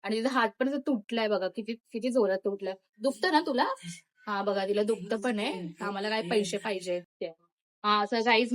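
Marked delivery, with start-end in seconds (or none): none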